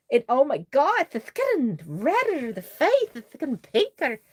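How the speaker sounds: high-pitched squeal of delight over the doll; excited gushing. Real, not acted. Gushing over doll's paint changing.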